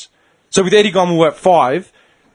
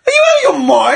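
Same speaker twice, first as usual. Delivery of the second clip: high, raised-voice disbelief; retold outrage; 12 semitones higher, 3 dB louder than usual. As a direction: challenge the official, pitch leaping up.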